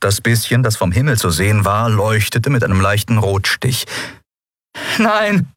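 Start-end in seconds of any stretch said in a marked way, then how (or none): 5.0-5.5 high, sudden cry of dismay; dismayed exclamation. A narrator's reading. Endriel realises the dream is lost, crying out high. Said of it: "rief Endriel aus".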